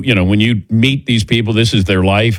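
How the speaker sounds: normal talking voice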